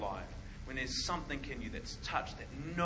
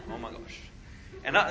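ordinary talk

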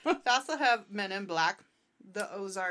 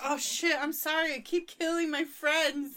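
lightly voiced apologetic drunk, higher pitch; Bigfoot apologizing; 5 semitones higher, 4 dB louder than usual. Drunk cryptid apologizing to the friends he squished, lightly hammed-up apology. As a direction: play a clumsy drunk owning up. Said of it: "He'd be like".

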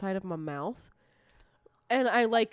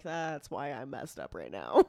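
low, slow self-quote; retold tipsy realization; 8 semitones lower than usual. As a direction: say it low and drawn out, amused. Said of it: "I'm like".

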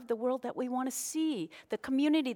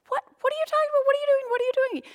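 much higher, bewildered squeal of protest; indignant disbelief; 4 dB louder, much faster than usual. Retold or imitated. Woman protesting her arrest to the officer, high indignant disbelief. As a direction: play the stunned driver, can't believe the arrest.

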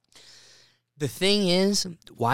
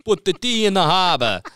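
higher, louder laughing mock Boston accent; 7 semitones higher, 7 dB louder than usual. Host mocks foreigners faking a Boston accent, laughing. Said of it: "Boston that's just like".

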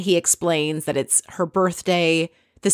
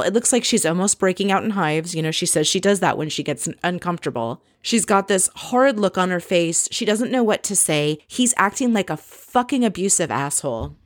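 normal talking voice

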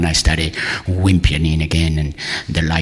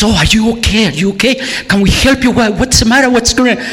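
high-pitched, flustered concern; alarmed receptionist; 14 semitones higher, faster than usual. Retold or imitated. Receptionist to a crying patient, alarmed and high. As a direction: fuss over someone in a high, worried voice.

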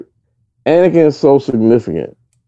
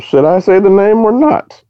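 slow, high, climbing question; mock deliberating; 10 semitones higher than usual. Co-host voicing her thinking about the name, mock hesitation. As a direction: drag it upward as if weighing it. Imagined thought of hesitating character.

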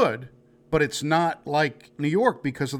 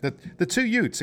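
Vinny two Utes; 8 semitones higher than usual. Retold or imitated. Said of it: "My cousin Vinny".

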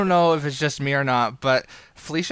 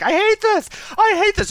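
very high, loud mock wail; imitated screaming; 4 dB louder than usual. Streamer struggling to take the deer down, mock screaming. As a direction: shriek it in mock distress, imitating her. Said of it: "just screaming and screaming and screaming".

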